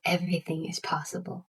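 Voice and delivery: usual speaking voice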